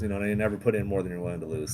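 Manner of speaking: ordinary talk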